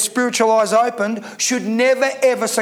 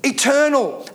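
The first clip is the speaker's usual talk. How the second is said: usual speaking voice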